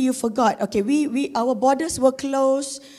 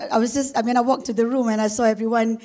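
ordinary talk

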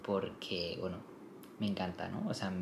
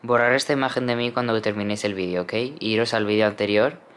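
loud plea; 9 dB louder than usual. Real, not acted. Begging viewers to forget her hair.

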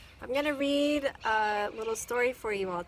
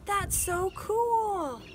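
slow, high, drawn-out awe; amazed; 10 semitones higher, 7 dB louder, much slower than usual. Acted. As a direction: savor the word cool. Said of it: "[name] marvels at robots".

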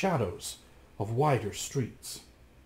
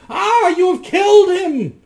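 much higher and louder, far from his own voice; shrill cry; 13 dB louder than usual. Acted. The magician accuses Rasmus, crying out in a shrill voice. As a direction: shriek the accusation. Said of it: "cried a shrill voice".

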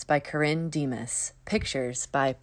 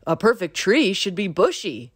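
a chirpy critter voice, a little louder; squirrel voice; 5 semitones higher, 5 dB louder than usual. A narrator's reading. Squirrel advises Bunny on bushy trees, chirpy critter voice. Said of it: "said Squirrel".

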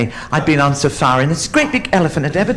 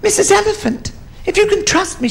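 tiny high-pitched mouse voice; little mouse. Acted. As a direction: pipe up as a small helpful mouse. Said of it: "this little mouse came and said".

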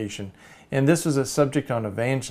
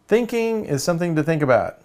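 loud, higher, mock-proud proclamation; triumphant announcement; 6 semitones higher, 6 dB louder than usual. Cartoon writer announcing his editorial title, mock triumphant. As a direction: announce it as an acted great discovery.

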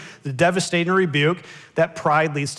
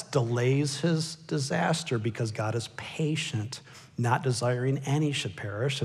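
normal talking voice

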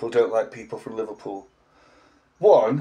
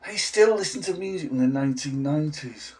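usual speaking voice

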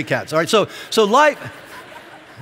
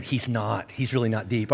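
much softer, lower mock murmur to a neighbor; hushed aside; 9 semitones lower, 9 dB quieter than usual. Congregant whispering to neighbor about the pastor, hushed mock aside. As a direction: mutter it like gossip in the pew.

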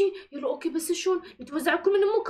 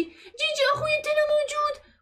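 calling for a brother; 7 semitones higher than usual. Pretend. Searching house for brother.

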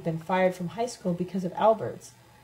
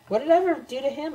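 higher, indignant narrated question; angry protest; 7 semitones higher, 3 dB louder than usual. A narrator's reading. Accused narrator answers Debbie and Lem, angry protest. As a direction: protest the accusation in a raised pitch. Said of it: "I asked angrily".

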